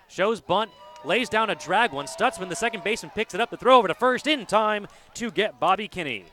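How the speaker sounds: rapid-fire excited play call; auctioneer speed. Real, not acted. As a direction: rattle it off like an auctioneer. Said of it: "Sounded like an auctioneer just then".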